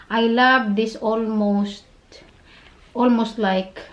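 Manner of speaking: normal talking voice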